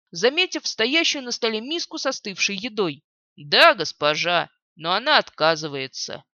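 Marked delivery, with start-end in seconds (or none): 3.4-6.2 low nervous guard. Acted. Guard answers mistress.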